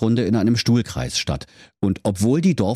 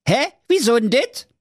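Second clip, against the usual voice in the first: high, baffled Berlin-dialect voice; puzzled Berlin hero; 3 dB louder than usual. Acted. Schnitzelschorsch puzzled at the king, high Berlin voice. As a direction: play him confused and cheeky.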